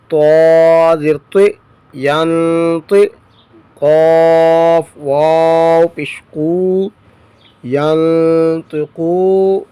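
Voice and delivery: slow, drawn-out spelling in a reciting tone; measured recitation drill. Shown as a demonstration. Teacher spells Quranic words aloud for students, measured recitation. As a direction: chant each letter and vowel as if before a class.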